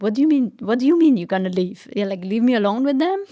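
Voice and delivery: imitating angry husband. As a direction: replay his anger at full volume. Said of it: "he was super angry".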